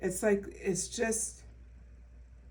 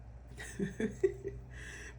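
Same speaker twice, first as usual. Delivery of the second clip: soft breathy laugh; 4 semitones higher, 7 dB quieter than usual. Real, not acted. Laughs at own yawning.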